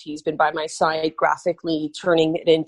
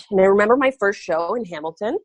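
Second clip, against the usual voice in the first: ordinary delivery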